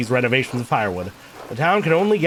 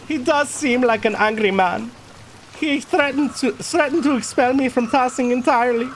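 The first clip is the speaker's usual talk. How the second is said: put-on higher-pitched German-accented character; 11 semitones higher than usual. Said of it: "went for the German accent with this guy".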